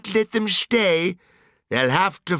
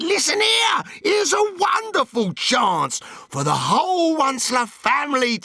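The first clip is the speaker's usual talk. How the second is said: excited shout; 8 semitones higher than usual. A narrator's reading. Once-ler calling his family to get rich, shouting. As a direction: shout the big news down the line.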